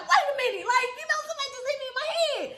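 high-pitched, rushed alarm; startled outcry. Retold or imitated. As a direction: blurt it out in startled protest. Retells being suddenly hit.

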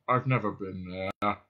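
very slow, low drone; depressed robot. Acted. Marvin answers Ford's greeting, depressed drone. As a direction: drag the words out in misery.

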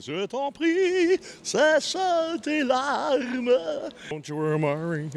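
high slow crooned French lines. Retold or imitated. Lightly sung love-song croon for the host.

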